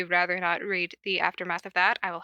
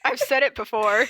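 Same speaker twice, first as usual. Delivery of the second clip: ordinary delivery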